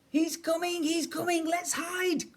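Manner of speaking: put-on high, urgent child's cry; alarmed cry. A narrator's reading. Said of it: "cried Eddie".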